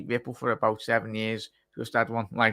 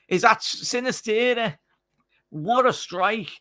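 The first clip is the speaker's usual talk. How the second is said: high, slow, amazed outburst; excited; 11 semitones higher, slower than usual. Real, not acted. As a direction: still buzzing from the goal. Right after the goal yell.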